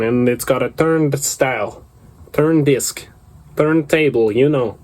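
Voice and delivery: hammed-up Russian accent, pitched a little up; put-on Russian accent. Riffing on Soviet Russia to listeners, in a put-on Russian accent. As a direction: ramble like a comic Soviet bad guy in a Russian accent. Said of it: "only do this to sound like a bad guy".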